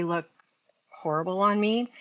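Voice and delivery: ordinary talk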